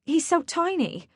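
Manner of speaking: a worried owner whispering her fear; desperate. Acted. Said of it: "with a desperate look at Macon".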